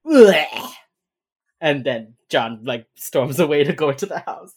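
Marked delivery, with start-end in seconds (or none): 0.0-0.7 exasperated groan. Retold or imitated. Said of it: "Hotch is like".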